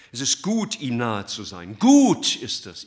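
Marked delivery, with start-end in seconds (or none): 1.8-2.8 high, loud, drawn-out "Gut ist es"; exclaimed. Real, not acted. Preacher calling out to the congregation, loud and high. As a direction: proclaim it with full force, slowly.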